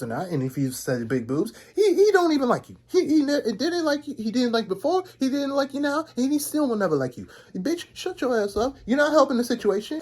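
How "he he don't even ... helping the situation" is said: higher, insistent ranting; heated rant. Real, not acted. Narrator ranting at the girl, heated. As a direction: tear into her, fed up.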